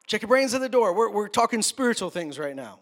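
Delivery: mock preacher. Old-time preacher ordering the congregation, loud mock preaching. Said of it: "when preachers would say things like".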